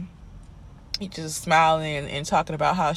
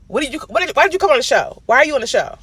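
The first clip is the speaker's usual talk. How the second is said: higher and louder, demanding questions; indignant rant; 8 semitones higher, 7 dB louder, much faster than usual. Real, not acted. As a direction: call her out, fed up and loud. Ranting at Keely, demanding why.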